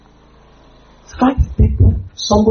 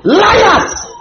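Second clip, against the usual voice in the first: preacher crying out at liars; anguished shout; 13 semitones higher than usual. Real, not acted. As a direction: hurl it out in anguish. Preacher yells at the congregation.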